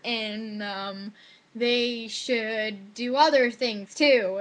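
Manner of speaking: slow, stretched-out words; mock essay composing. Student padding out a test essay, slow mock composing. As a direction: drag each word as if inventing it.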